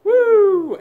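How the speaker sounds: high, loud victory whoop; excited whoop. Real, not acted. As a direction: whoop like you just won. Cheering after chugging bottle.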